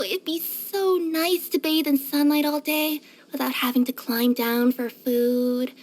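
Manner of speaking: slow, wistful daydream; dreamy. Acted. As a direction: drift off slowly into a pleasant reverie. Daydreams of sunny treetop naps.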